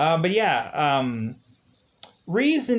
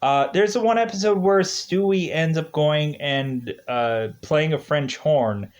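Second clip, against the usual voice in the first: ordinary talk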